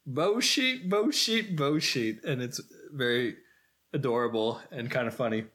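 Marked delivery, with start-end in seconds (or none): 0.1-2.2 mocking British accent, starting high and dropping; singsong fake British put-down. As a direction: mimic someone's British accent in mockery. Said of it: "doing a fake British accent".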